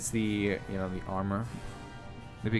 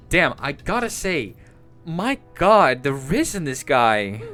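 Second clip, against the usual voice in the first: loud, gushing; swooning over a character; excited; 9 dB louder than usual. Real, not acted.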